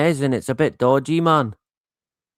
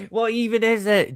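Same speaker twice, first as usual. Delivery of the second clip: higher-pitched, mock-baffled outburst; exasperated bewilderment; 6 semitones higher than usual. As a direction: imitate people at their wits' end. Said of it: "pulling their hair out".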